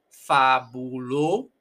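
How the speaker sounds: "fabulo" broken off on a long held O; O sound. Shown as a demonstration. Teacher isolating the first O in "fabulo", held long. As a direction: stretch "fabulo", keeping the O a true O. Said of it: "está con sonido de O".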